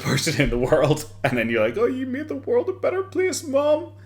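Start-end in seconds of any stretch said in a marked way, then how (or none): 1.8-3.9 very high mock-earnest son voice; sarcastic imitation, pitched way up; mocking tribute. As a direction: put on a high voice to mock a son's praise. Mocks Boogie addressing dead mom.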